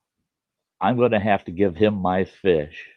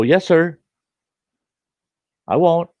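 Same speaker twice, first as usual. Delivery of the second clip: put-on higher, louder polite boy; young boy's voice; 11 semitones higher, 7 dB louder than usual. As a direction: chirp it like a scared, polite kid. Boy politely answering angry neighbor.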